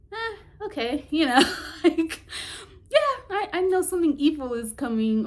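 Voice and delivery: high, laughing, careless shrug; a lightly hammed-up casual shrug; mock nonchalance. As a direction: play it like doom is no big deal. Mimicking someone shrugging off doom.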